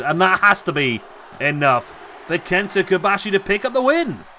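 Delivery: lively ringside wrestling call; wrestling commentator calling the match live; live play-by-play. Acted. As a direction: call every move as it lands.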